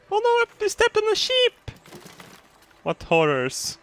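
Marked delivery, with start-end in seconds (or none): 0.1-1.7 very high, squeaky alarm; cartoon panic in a tiny voice; hammed-up high-pitched oh no; mock dismay.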